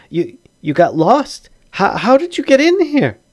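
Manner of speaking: high, climbing voice of disbelief; startled, baffled halfling. Acted. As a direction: ask it in high-pitched bewilderment. Halfling startled by intruders in his home.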